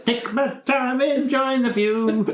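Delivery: high, laughing little creature voice; chirpy high voice through laughter; high character voice. Acted. Winkle voice.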